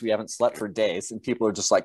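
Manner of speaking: normal talking voice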